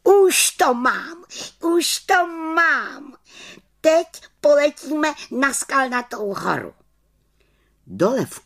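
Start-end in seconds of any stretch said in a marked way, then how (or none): 0.0-6.7 high, elated exclamation; high, jubilant witch voice; triumphant little witch. A narrator's reading. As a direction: cry out a discovery as a small witch. Witch finds the spell, exclaims.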